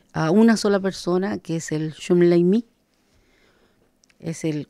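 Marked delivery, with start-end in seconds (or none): none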